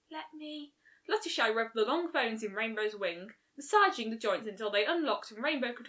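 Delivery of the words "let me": much softer, higher, gentle little offer; barely breathing the words as she reaches out. A narrator's reading. Fluttershy softly offers help.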